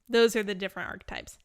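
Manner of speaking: usual speaking voice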